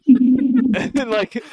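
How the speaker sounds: normal talking voice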